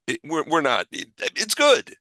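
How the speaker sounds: ordinary talk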